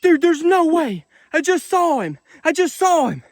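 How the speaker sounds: high, loud, breathless no-way shouting; can't believe his eyes, yelling high and loud; thrilled disbelief. Real, not acted. Spots rare albino catfish.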